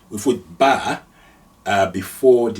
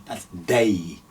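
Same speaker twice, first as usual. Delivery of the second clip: ordinary talk, nothing marked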